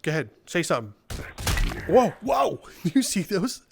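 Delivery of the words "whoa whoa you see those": surprised whoa. Real, not acted. Gamer startled by the boss's moves, high surprised whoa. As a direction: caught off guard by a cool move.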